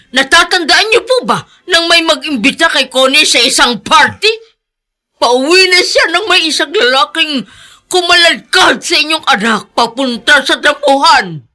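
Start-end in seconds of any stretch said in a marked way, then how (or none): none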